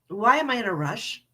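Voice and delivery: ordinary talk, nothing marked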